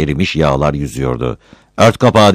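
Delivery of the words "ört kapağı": louder, higher scolding cry; sharp scolding shout. Acted. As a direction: snap at him to cover the pot. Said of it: "diye bağırdı kadın".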